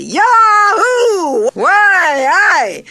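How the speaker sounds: very high, loud triumphant shouts; joyful yelling. A narrator's reading. Charlie yelling in flight to everyone, joyful. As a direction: yell with delight. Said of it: "cried Charlie joyfully".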